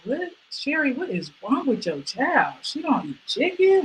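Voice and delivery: a hint of aghast aunties; mock shocked relatives. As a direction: relatives asking Sherry about her child. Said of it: "People be like".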